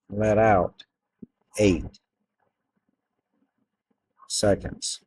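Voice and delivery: slow calm hypnotist voice; guided meditation. Acted. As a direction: ease them into relaxing, unhurried. Starts a hypnosis induction.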